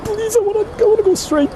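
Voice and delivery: whining please to be let through; high whiny plea. Real, not acted. Stuck, pleading to go straight.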